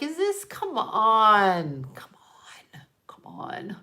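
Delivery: drawn-out plea dropping into a whisper; exasperated plea. Retold or imitated. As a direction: let the disbelief fade to voiceless begging. Retelling disbelief on seeing it.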